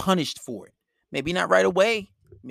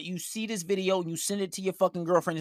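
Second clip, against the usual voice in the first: ordinary talk, nothing marked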